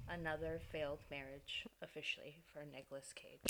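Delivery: low, hushed, mournful news; mock sad announcement. Host breaking news of Cage's failed marriage, mock sad. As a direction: deliver it softly over imagined sad music. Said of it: "I'll put some sad music in here".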